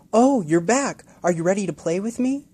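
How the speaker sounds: put-on high, loud delighted child; excited boy. Boy greeting the returning bluebird, excited. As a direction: burst with joy at the bird.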